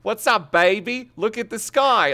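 a light mocking imitation of the cheesy line. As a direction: parrot it back, ridiculing. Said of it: "What the fuck did you just say?".

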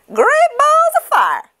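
very high, loud goose holler; excited cry. A narrator's reading. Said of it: "she cried".

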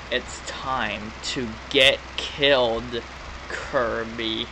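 low, slow, soft villain voice; drawn-out sinister game villain; villain threat. Acted. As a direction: read a death threat with slow menace. Reads game villain's threat aloud.

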